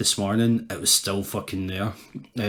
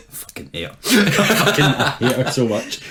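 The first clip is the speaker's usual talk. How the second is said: laughing; 5 semitones higher, 4 dB louder than usual. Real, not acted. Laughing about flatmate.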